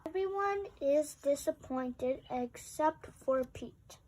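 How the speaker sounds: plain reading voice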